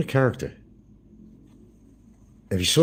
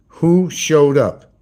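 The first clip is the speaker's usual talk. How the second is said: "Who showed up?" stretched very slowly, louder; drawn-out teasing; 5 semitones higher, 6 dB louder, much slower than usual. Real, not acted. Vlogger baiting his viewers to guess the visitor, slow and loud. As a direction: drag each word out for suspense. Repeats the question to tease.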